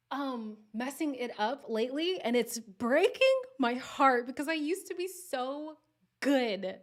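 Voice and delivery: mock heartbreak. Speaker bemoaning a slipping UK accent, loud mock heartbreak. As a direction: wail it theatrically, drawing out the words.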